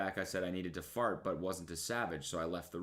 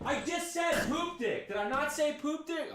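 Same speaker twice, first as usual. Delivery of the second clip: shocked shout. Real, not acted. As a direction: squeal it in shocked disbelief. Shocked at own words.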